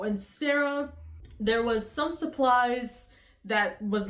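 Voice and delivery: ordinary delivery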